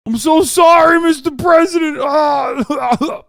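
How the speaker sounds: high, loud blubbering New York cop; shout-sob like a thick New York cop; New York cop accent. Pretend. Streamer imagines weeping New York cop arresting Trump, loud New York accent. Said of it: "They'd be like".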